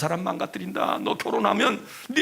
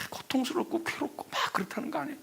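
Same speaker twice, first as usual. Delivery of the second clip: hushed whisper; 10 semitones higher, 8 dB quieter than usual. Real, not acted. Preacher describing the shaman's agony, whispered.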